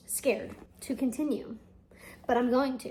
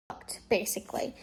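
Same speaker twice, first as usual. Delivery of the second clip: whispered aside; hushed, secretive; 4 semitones lower, 7 dB quieter than usual. Real, not acted. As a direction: drop to a whisper.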